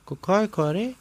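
high, questioning henchman voice; high confused mumble to himself; puzzled repeating. Acted. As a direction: mull it over aloud, baffled. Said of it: "با خودش تکرار کرد".

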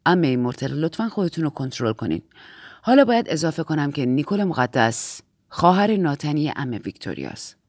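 low, controlled authority; low-pitched, restrained superior; composed Aunt Lydia figure. Acted. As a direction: rein them in with a low, softening voice.

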